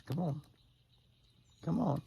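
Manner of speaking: high, soothing coax to a nervous buck; coaxing call to deer. Real, not acted. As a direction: settle him with a high friendly call.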